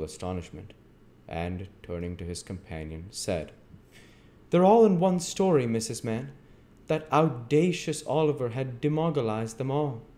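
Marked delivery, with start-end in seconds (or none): 4.5-10.0 high, loud, scandalised declaration; astonished beadle. Acted. Bumble turning to Missus Mann, astonished. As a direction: pronounce it in pompous shock.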